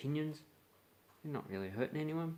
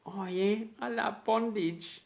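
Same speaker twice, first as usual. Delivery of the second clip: much higher, slow put-on voice of an odd person; weirdo voice; 10 semitones higher, 5 dB louder, slower than usual. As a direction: play the strange guy, drawn out and high. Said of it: "Like when someone goes".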